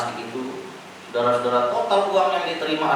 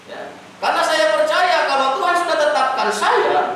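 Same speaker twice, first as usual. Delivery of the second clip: high, loud testimony, voice lifted; preaching at full force; 14 semitones higher, 4 dB louder than usual. Acted. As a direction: proclaim it to the congregation with fervour. (bersaksi dengan suara tinggi dan keras)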